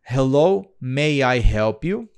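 slow, deliberate English practice read; slow careful reading. Shown as a demonstration. Teacher modelling a slow read for the student, slow and careful. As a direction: read it out slowly, word by word. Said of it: "eu posso fazer a leitura".

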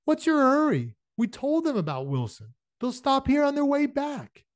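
high-pitched grumbling protest. A narrator's reading. Henry Shipstead protesting Shane's hurry, grumbling. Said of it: "Henry Shipstead was grumbling".

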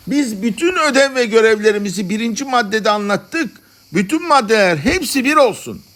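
high-pitched call to the assembly; shouted proposal. A narrator's reading. One madman to the congress, shouting his motion. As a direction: cry it out over a crowd. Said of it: "Bir deli şöyle bağırdı".

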